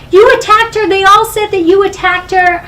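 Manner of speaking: high, loud retold accusing; dad's angry accusation. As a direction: replay a father insisting on the blame.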